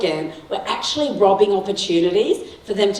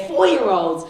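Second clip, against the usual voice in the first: usual speaking voice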